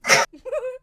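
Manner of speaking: loud high burst of laughter; cracking up. Real, not acted. Laughs at own impression.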